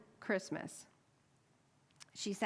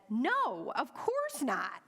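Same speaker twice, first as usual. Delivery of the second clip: high, loud, slowed refusal; surprisingly sharp no to the loan officer; 5 semitones higher, 3 dB louder, much slower than usual. Retold or imitated. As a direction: snap it back before you can stop yourself. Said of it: "in a tone that surprised us both".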